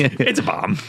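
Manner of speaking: lightly voiced smug architect; smug [name]. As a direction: say it like a proud secret. [name] answering again.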